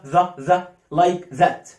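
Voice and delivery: the said slowly with a voiced th. Shown as a demonstration. Teacher demonstrating the voiced th. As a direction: buzz the the slowly. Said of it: "makes the sound the".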